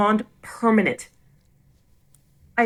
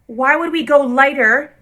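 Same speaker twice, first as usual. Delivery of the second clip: louder, slow and higher, pressing each point; exasperated confrontation; 6 semitones higher, 4 dB louder, slower than usual. Retold or imitated. Standing up to the salon staff, fed-up and insistent. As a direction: push back hard at the stylist who is lying.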